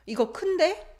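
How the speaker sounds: 큰데 lifted high like a question; surprised, questioning lift on 큰데; rising intonation. Shown as a demonstration. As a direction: end 큰데 on a rise. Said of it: "always used with rising intonation".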